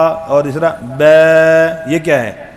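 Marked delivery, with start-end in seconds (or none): 0.8-1.9 بے drawn slowly and louder, leaning toward e; imala mistake. Shown as a demonstration. Teacher showing the wrong imala on the letter با. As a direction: say بے with the vowel bent toward e. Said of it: "امالہ".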